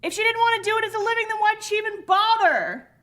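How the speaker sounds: fast, high-pitched complaint; comic exasperation. Real, not acted. Fan fed up with the music student, comic exasperation. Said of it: "I'm so mad about these teens".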